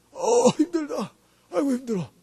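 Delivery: acted tired whine; weary groaning. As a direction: moan it like someone worn out, dragging the words. Acting out weary homecoming.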